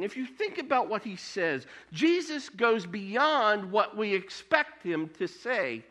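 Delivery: normal talking voice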